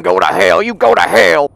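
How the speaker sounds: loud, indignant telling-off; retold angry outburst. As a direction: raise your voice as you re-enact it. Retells telling them off.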